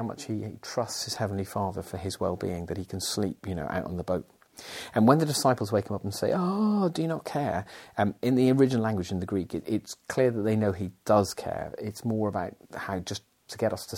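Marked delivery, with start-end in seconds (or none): 6.3-7.7 panicked plea. Retold or imitated. Acting panicked disciples.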